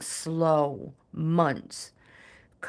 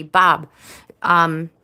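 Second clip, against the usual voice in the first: calling out; 11 dB louder than usual. Real, not acted. Calling Bob again.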